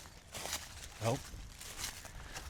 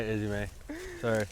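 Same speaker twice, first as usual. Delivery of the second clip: ordinary talk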